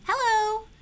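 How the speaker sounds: very high, sung-out hello; high trumpeting greeting; trumpeting penguin call. A narrator's reading. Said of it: "She starts trumpeting".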